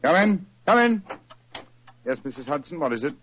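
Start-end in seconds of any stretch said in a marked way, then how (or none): 0.0-1.0 calling out. Acted. Calling to someone at the door.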